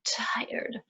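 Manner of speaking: whispered tired. Acted. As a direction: sigh it out in a whisper. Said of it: "I am so".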